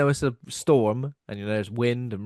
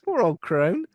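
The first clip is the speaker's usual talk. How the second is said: mock pity; 7 semitones higher, 5 dB louder, slower than usual. Real, not acted.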